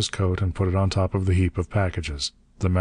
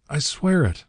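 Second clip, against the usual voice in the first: high, anguished insistence; panting agony; 8 semitones higher than usual. A narrator's reading. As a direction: swear it desperately, as if in agony. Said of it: "panted the master in agony".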